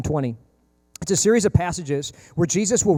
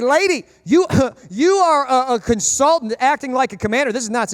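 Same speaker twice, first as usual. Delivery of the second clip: loud, high, incredulous scolding; exasperated onlooker; 7 semitones higher, 5 dB louder than usual. Retold or imitated. Preacher voicing a bystander scolding the pushy mother, high and exasperated. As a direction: lay into the meddling mom, laughing in disbelief. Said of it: "It's like".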